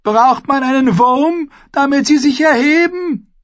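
high, loud, cheeky taunt; bold jeering call. A narrator's reading. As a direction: tease the bride's family with a grin. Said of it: "rief Alex Fry verwegen".

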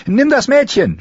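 very high urgent yell; screaming. A narrator's reading. Arya handing Gendry the girl, screaming. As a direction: scream it as a desperate order. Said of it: "schrie sie".

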